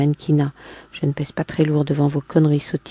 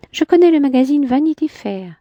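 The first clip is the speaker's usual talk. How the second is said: higher, lightly voiced woman; a hint of a proud woman; Clémentine's voice; 10 semitones higher, 3 dB louder than usual. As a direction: lift into her haughty tone. Man voicing woman in dialogue.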